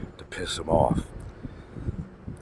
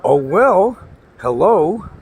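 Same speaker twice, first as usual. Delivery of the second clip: loud, drawn-out, higher-pitched sarcasm; sarcastic dismissal; 10 semitones higher, 5 dB louder, much slower than usual. Real, not acted. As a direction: throw it back at him, loud and mocking. Mocking the rival's complaint.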